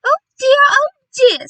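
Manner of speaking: very high, very loud lament; distressed cry. A narrator's reading. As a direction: wail it out in dismay. Mother bird laments lost house.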